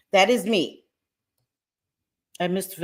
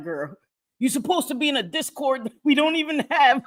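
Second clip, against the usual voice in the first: higher, playful calling out to a friend; teasing bestie call; 6 semitones higher than usual. Retold or imitated. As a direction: nag her friend in jest, higher. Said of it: "I keep telling her".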